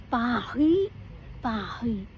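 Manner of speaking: "Paris" with a uvular R, "pa-hi"; French Paris. Shown as a demonstration.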